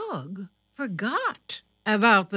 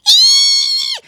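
very high, loud, long squeal; 14 dB louder than usual. A narrator's reading. Little sister squeals in danger, a loud high squeal. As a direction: let out a piercing little squeal. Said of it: "heard a squeal".

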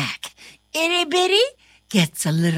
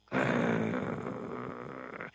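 much lower, softer growl; dog growl; 15 dB quieter than usual. Acted. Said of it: "says the firehouse dog".

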